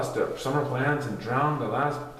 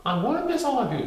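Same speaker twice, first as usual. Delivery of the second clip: higher, quicker teacher voice; a light, sweet teacher voice; 12 semitones higher, faster than usual. Acted. Teacher saying goodbye to her class, higher and fast.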